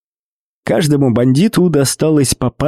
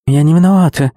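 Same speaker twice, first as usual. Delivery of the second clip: near tears; 3 semitones higher, 3 dB louder, faster than usual. Acted. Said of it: "чуть не плача ответила Кэт".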